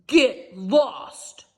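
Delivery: loud gruff brush-off. Acted. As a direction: snap it out, short and rude. Junkyard guy's rude reply.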